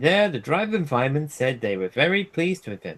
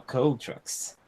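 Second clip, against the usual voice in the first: ordinary delivery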